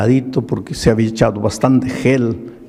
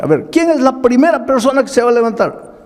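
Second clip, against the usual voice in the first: calling out; 11 semitones higher than usual. Retold or imitated. Pastor calling on the congregation.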